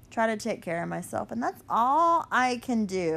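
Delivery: normal talking voice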